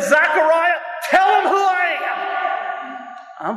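high, impassioned preacher's scream. Acted. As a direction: cry it out as a ringing command, stretching the end. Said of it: "I'm sorry, I'm screaming".

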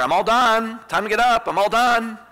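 playful imitation of an impatient toddler; toddler calling out. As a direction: imitate a small girl calling from her crib. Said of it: "she screams in the baby monitor".

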